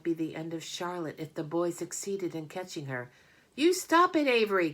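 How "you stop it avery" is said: put-on high, loud girl's cry; shrill-free, high loud protest. A narrator's reading. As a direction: yell to stop him. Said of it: "cried Fern".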